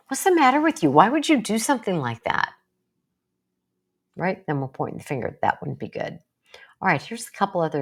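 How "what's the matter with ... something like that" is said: accusing, raised and higher; a mock blaming outburst. Shown as a demonstration. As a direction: snap it out like pointing a finger. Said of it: "You didn't say".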